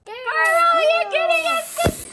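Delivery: high, loud joyful shout; excited shout. Real, not acted. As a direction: announce it with pure delight. Gender reveal to child.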